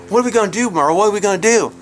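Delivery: high, frantic mimicked plea; upset family. Storyteller as the mess-covered family begging Merle, high mock panic. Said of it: "the dad is upset at this point".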